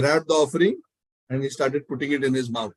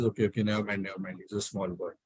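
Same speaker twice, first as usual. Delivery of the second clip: low, soft, retold reassurance; imitating a priest letting it go; resigned forgiveness; 7 semitones lower, 8 dB quieter, faster than usual. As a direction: wave it away quietly, voice sinking.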